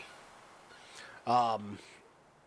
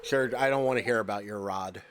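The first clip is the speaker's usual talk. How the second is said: ordinary delivery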